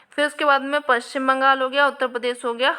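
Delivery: ordinary delivery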